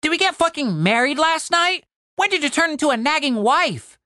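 high, incredulous sidekick; Tim, exasperated. Acted. Tim mocks Cooper's nagging, exasperated.

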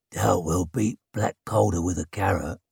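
deadpan like a grim warrior; gruff man voice. Acted. Shivers dry low reply.

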